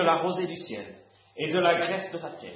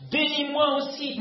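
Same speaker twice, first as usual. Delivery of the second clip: high pleading cry, a touch louder; bitter cry; 10 semitones higher, 3 dB louder than usual. A narrator's reading. Esau begs his father for a blessing, pleading cry. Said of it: "il poussa de forts cris pleins d'amertume".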